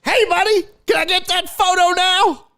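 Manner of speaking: put-on high and loud, eager old man voice; creepy dad voice. Dad asking the kid for a photo, high loud eager voice. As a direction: call out like an overeager dad. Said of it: "you see the dad go".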